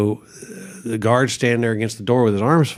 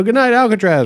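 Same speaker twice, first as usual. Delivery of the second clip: much higher, louder hammed-up farewell to Alcatraz; mock showman sign-off; 11 semitones higher, 5 dB louder than usual. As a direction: sign off like a cheesy rock star leaving the stage.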